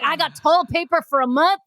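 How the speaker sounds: husband boasting about supplies, mock Southern; Southern accent. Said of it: "the accent came out".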